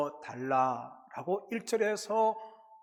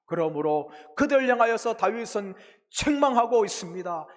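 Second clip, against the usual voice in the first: usual speaking voice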